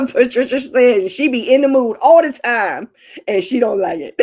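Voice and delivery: can barely get it out for laughing; laughing through words. Real, not acted. Reading a viewer's comment live, laughing through it.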